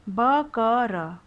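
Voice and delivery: ब क र very slow, each sound apart; spelled out slowly. Shown as a demonstration. Teacher spells a word sound by sound. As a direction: sound out ब, क, र one by one.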